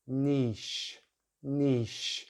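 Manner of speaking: "niche" as neesh, lips spread; "niche" with long ee; British pronunciation. Shown as a demonstration. Teacher drills long vowel, "niche" said neesh. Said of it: "spreading the lips".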